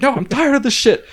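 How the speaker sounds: put-on loud, high drunken outburst; enraged drunk. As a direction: snap back in a drunken rage. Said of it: "I was, like, enraged".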